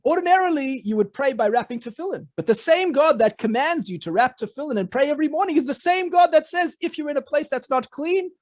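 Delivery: urging his brother to see the point; eager explaining. Acted. Elimelech explains eagerly to Zusha.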